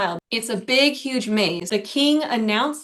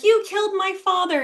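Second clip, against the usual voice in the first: much higher, hammed-up outrage; mock accusation; 9 semitones higher than usual. Heroine confronting the king, in mock fury. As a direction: fling the accusation theatrically. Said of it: "she's like".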